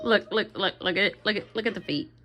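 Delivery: dropping the voice to show the feet. Real, not acted. Showing the doll's bad feet.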